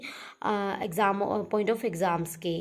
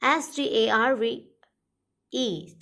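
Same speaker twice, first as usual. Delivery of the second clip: slow spelling out; much slower than usual. Shown as a demonstration. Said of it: "spelling देख लीजिएगा".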